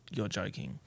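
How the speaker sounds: low, hushed retold reply; stunned disbelief. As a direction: drop right down, barely believing it. Retells own stunned reply.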